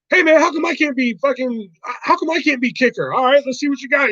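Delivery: high, pushy whining jock voice; hammed-up whiny movie quote; movie character impression. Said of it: "the guy that always was yelling".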